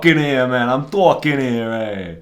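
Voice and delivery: loud, hammed-up New York wise guy; brash New York street-guy impression; mock New York accent. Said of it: "that's the New York accent".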